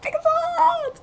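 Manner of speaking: high, drawn-out squeal; gleeful high-pitched call; elated cheer. Real, not acted. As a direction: cry it out like a devoted fan. Cheering favourite moment.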